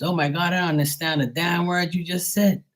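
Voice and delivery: high-pitched retold bewilderment; mock astonishment. Bostonian baffled by Bermudian slang, mock astonishment. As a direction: imitate someone utterly lost.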